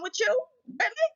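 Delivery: high, disbelieving rise; sharp rising incredulous question. Real, not acted. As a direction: challenge their disbelief, pitch leaping. Incredulous challenge to doubters.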